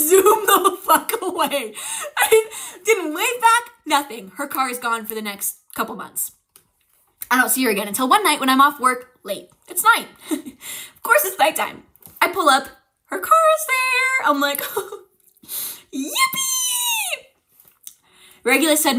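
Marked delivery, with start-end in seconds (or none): 0.1-3.7 laughing through the words; giggling as she tells it. Real, not acted. Laughing while retelling escape. 13.0-17.2 very high sarcastic yippee squeal; mock excited squeal. Sarcastic dread, car is back.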